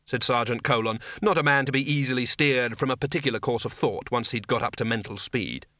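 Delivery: usual speaking voice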